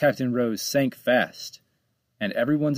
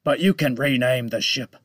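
menacing shark voice. Acted. Shark shrugs off the drowning.

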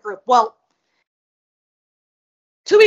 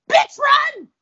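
high, loud panicked yell to herself; retold shout; 14 semitones higher, 4 dB louder than usual. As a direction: yell it as a sudden alarm. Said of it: "the next thing I said to myself was".

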